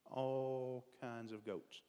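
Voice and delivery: slow soft echo. Shown as a demonstration. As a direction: let "All kinds of goats" sink away slowly. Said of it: "The rich man has all kinds of goats".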